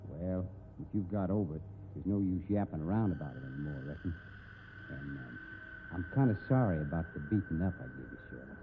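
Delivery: low, soft, sheepish apology; gruff tenderness. Acted. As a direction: make peace gently.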